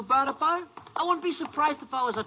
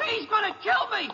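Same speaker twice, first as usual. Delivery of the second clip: high, panicked cry; terrified; 10 semitones higher than usual. Acted. Prisoner tells the ranger he will be killed, terrified. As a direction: blurt it out in terror.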